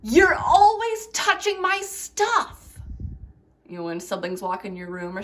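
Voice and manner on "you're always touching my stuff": high, loud, slow complaint; loud high sibling grievance; annoyed sibling. Shown as a demonstration. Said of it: "How about".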